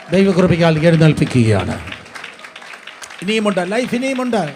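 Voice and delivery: loud, rousing promise to the congregation; building, shouted preaching; full-force preaching. Real, not acted. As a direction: drive each phrase home at high volume.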